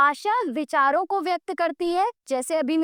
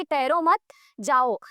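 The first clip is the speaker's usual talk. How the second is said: ठहरो मत joined and rising, pause, lower जाओ; telling a relative to leave; 3 semitones lower than usual. Acted. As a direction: break after मत, then drop on जाओ. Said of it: "दूसरे में अपने रिश्तेदार से कह रही हैं". (ठहरो मत साथ, रुककर नीचे सुर में जाओ)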